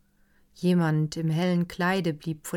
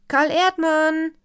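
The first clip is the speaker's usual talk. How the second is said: much higher, louder, drawn-out call; 11 semitones higher, 10 dB louder than usual. A narrator's reading. Someone on the veranda calls Karl loudly. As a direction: call his name across the garden. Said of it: "Jemand rief".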